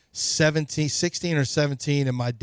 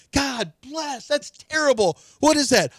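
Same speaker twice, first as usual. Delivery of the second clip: mock disgust, pitched much higher; disgusted dad; 11 semitones higher, slower than usual. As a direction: play the grossed-out parent reacting to the smell. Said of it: "they kept going".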